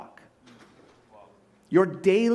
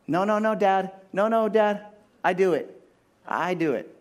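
put-on higher whiny toddler refusing help; stubborn toddler; 4 semitones higher than usual. Pastor imitating a toddler telling Dad no, higher and whiny. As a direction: play a small child pushing Dad away. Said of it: "All the kids, right?".

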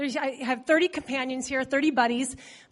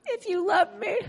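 higher, a hammed-up fake cry; mock whiny plea; 6 semitones higher than usual. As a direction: plead like a sulking bride. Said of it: "you know how you do it".

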